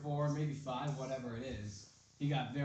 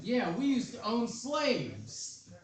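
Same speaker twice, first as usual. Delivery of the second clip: much higher, very slow proud announcement; mock proud brand voice; 7 semitones higher, slower than usual.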